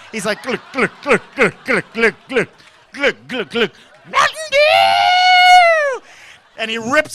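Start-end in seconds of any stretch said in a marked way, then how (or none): 0.4-3.7 loud, rhythmic gulping noises; throaty mock glugs in a steady beat; glugging imitation. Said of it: "taking it to the head like a rapper". 4.1-6.0 huge, high, drawn-out scream; imitating an ecstatic caffeinated yell; excited brother. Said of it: "he's so excited".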